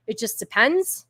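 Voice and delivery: ordinary delivery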